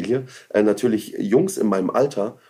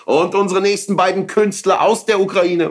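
higher, louder announcer voice; circus announcer; 9 semitones higher, 4 dB louder than usual. Retold or imitated. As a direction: announce the next act. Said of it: "hab so ein bisschen anmoderiert".

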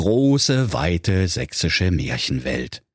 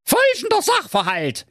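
high, pompous little king calling out; King Curry. A narrator's reading. Currywurst king calling Thorsten over on the steps, high and bossy.

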